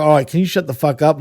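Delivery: normal talking voice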